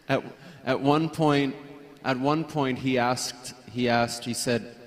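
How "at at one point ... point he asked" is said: ordinary delivery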